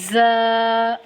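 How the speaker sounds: زا with a hard buzzing z, held long; firm, drawn-out زا. Shown as a demonstration. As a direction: press the z of زا and sustain it. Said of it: "سختی سے پڑھیں گے".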